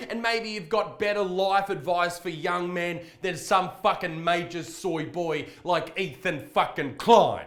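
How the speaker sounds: ordinary talk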